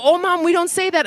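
put-on high little kid's voice, correcting mom; child voice. Child scolding mom for saying something wrong, high and earnest. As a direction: play the child scolding mommy.

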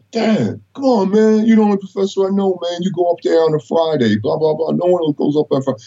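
teasing daughters. Retold or imitated. Daughters voiced teasing dad.

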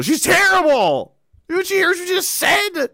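high-pitched incredulous outburst; mock outrage. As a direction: protest in exaggerated, squealing disbelief. Acted outburst at the show's producer.

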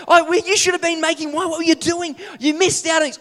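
loud, high, worked-up shout; hammed-up disciple rubbing it in; excited telling-off. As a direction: scold your friend in disbelief. Disciple scolding Thomas excitedly.

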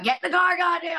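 high-pitched, raspy cursing dad; dad's raspy voice. Retold or imitated. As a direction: imitate a raspy dad ordering the kids out. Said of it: "Doing my dad's raspy voice".

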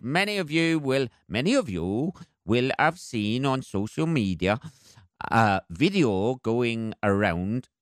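put-on a light Welsh accent, slowing at the end. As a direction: read the police post in a mild Welsh accent. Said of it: "that's as far as I can get with my Welsh accent".

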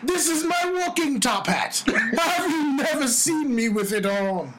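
hammed-up top-hatted British gent accent; mock posh British accent. Shows friends his top hat, in a mock posh British accent. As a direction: show off like a stuffy British gentleman. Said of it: "more and more like Mister Peanut".

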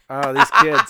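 loud, laughing; exasperated fond laugh; laughing sigh. Real, not acted. Host laughs about the kids, loud.

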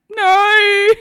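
high, loud mock cry; wailed refusal. Mother in labour refusing offered morphine, high loud mock wail.

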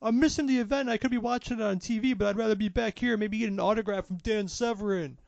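excited fan. Retold or imitated.

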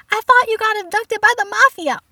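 high, loud panicked repeat; parent freaking out. Retold or imitated. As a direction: imitate a frantic parent at full pitch. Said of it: "they start freaking out".

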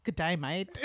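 attempted Australian accent, "day" said like "die". Shown as a demonstration. Guest attempts Australian greeting on request, Australian accent. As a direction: try a careful Australian greeting. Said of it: "in an Australian accent".

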